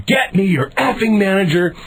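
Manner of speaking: high, angry demand; re-enacted screaming. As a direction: act out an irate customer demanding satisfaction. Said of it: "I start screaming".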